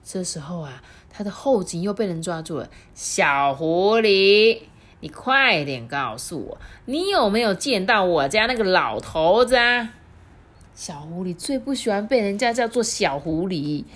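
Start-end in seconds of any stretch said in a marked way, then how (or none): 2.9-10.0 grab the fox and demand answers; loud voice of a bossy old wife; angry old woman. Acted.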